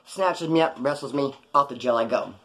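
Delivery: usual speaking voice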